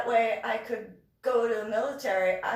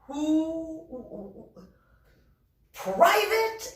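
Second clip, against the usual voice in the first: high, drawn-out disbelieving "who", then louder; imitated commander; 7 semitones higher, 4 dB louder, much slower than usual. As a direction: imitate an officer calling out a private. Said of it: "He goes".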